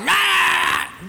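high, loud hammed-up yelling roar; mock roar. Husband roaring at his wife, mock furious yell. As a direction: let out a cartoon roar at her.

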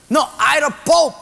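put-on loud, high Portuguese-accented protest. Patient insisting he is Pope, comic Portuguese accent. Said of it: "the two Portuguese".

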